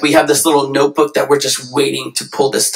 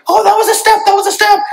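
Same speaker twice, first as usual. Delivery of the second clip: put-on high, loud, thrilled cheer over a baby's step; excited parent; 5 dB louder, faster than usual. Parent cheering their baby's wobbly step, excited squeal. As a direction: squeal with joy at the first step. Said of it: "You're excited".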